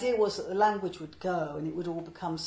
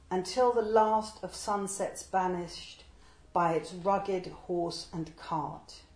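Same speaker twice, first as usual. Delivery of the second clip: slow, measured reading of a rhyming poem; poem recital; 4 dB louder, slower than usual. Acted. Translator reading his poem to an audience, measured recital. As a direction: declaim each line with weight and pauses.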